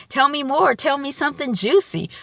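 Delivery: hungry for the gossip; eager Issa. Retold or imitated. Girlfriend coaxes her boyfriend for juicy details, eager.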